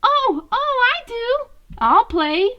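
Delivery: put-on very high, eager little-kid squeal of a yes; bouncing eagerness. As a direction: jump at the chance to play. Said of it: "Mikey was the first to bounce".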